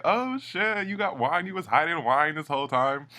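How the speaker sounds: high, excited mock gasp; surprised housemates. Housemates amazed she hid wine, high surprised voice. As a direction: squeal it like they're shocked. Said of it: "they're like".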